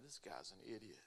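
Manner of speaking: muttered mockery of the rabbi; mocking buddies. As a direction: sneer at the newcomer quietly. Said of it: "busting out laughing".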